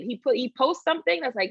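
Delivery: ordinary talk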